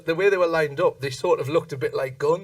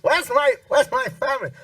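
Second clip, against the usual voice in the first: higher, plaintive calling out; a lost old man calling for his family; 10 semitones higher than usual. Retold or imitated. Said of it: "this guy going".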